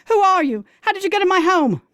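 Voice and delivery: high, loud panicked scream. A narrator's reading. Woman screaming at a strange naked man in her bed. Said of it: "I scream".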